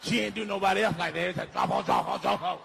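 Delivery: loud, low retold rant; worked-up cousin, loud and growling low; cousin's angry rant. Retold cousin ranting about Uno.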